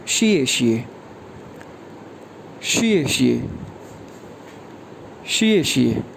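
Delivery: शिए, शिए each dropped sharply; falling fourth tone. Shown as a demonstration. Teacher drilling thanks as शिए शिए, falling. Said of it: "four tone के साथ".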